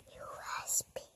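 soft unvoiced whisper; sleepy bedtime whisper. Real, not acted. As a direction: whisper it very quietly.

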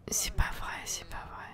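whispered under the breath in disbelief; despairing whisper. Real, not acted. Player whispering in despair at the game. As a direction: breathe it out in defeat.

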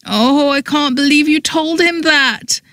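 high, strained dismay; appalled disbelief. A narrator's reading. Mortified woman to meddling friend, appalled. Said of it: "I said again".

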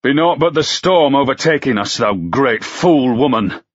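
put-on John Hardy scolding the girl over the storm; exasperated scold. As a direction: snap at the foolish woman.

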